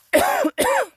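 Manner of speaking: sharp real cough from a swallowed bug; hard cough. Real, not acted. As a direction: cough hard to clear the throat. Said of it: "just swallowed a fly".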